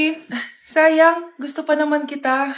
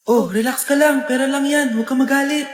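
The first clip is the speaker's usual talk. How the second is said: lower, steady reassurance; composed voice of restraint; calming; 4 semitones lower than usual. Acted. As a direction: talk a temper down gently. Soothing words against rising anger.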